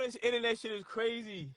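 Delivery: usual speaking voice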